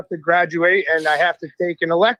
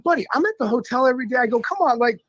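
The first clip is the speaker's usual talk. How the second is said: higher-pitched retold protest; exasperated pleading; 5 semitones higher than usual. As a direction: plead your case to the teacher. Retold pleading argument with the teacher.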